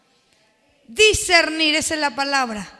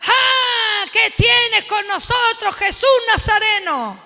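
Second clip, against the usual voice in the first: high, loud demon cry; demon shouting; 5 semitones higher, 8 dB louder, slower than usual. Acted. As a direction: leap up and yell like a possessed man. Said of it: "salta uno por allá".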